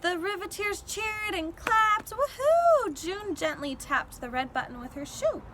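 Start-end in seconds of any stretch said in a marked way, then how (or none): 2.1-2.9 very high, loud whoop; cheer. A narrator's reading. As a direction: cheer like the invention worked. Riveteers cheering.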